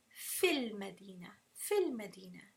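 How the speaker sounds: "fil Madina" run together with a short i in "fil"; joined, mad dropped. Shown as a demonstration. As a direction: join it as one "fil Madina", no long vowel. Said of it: "See how the mad disappears when I join them together".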